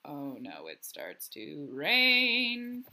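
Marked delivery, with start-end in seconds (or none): none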